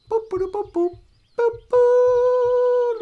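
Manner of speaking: high, bright boops climbing into a beep; sing-song machine beeping noises; computer boops. Pretend. Imitating computer beeps.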